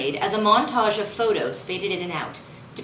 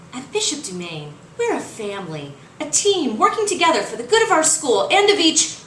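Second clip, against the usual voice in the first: chirpy school-ad announcer, rising higher and louder at the end; light promo voice; 7 semitones higher, 4 dB louder than usual. Acted. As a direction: sell the school like a cheerful promo. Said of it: "a light voice chimed in".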